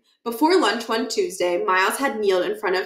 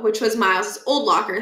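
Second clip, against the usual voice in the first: usual speaking voice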